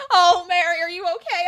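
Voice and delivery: imitating a worried mom; acted alarm. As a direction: fuss over a child in mock panic. Worried mom rushes over.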